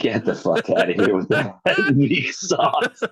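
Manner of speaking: real laugh breaking into the words; amused heckle through laughter; laughing dismissal. Real, not acted. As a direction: send him off while cracking up. Co-host laughing while dismissing the joke.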